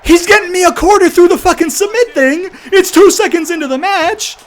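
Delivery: high, loud outraged shouting; frustrated yelling. Real, not acted. Gamer protesting an unfair submission, frustrated yelling. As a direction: yell in real exasperation at the game. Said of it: "how much I'm fucking yelling".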